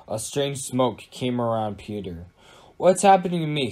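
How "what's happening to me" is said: raised, louder cry of alarm; mild scream. A narrator's reading. Peter as he turns to dust, mild scream. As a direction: cry out in understated panic. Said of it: "screamed Peter".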